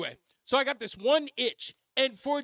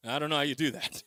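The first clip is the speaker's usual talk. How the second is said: deep baffled trainer; 7 semitones lower, faster than usual. Retold or imitated. Personal trainer's baffled reply.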